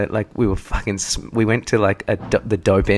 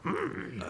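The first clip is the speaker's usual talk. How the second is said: very high, soft hum; tiny quiet squeak of a hum, in mimicry; mock Dalai Lama hum; 17 dB quieter than usual. Listener imitates the Dalai Lama speaking, high soft mock hum. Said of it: "I've seen him speak".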